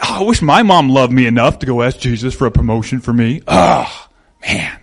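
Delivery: a low, whiny mock lament for a mom's favour; jealous disciple grumbling. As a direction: sulk it out like a jealous disciple, lightly voiced.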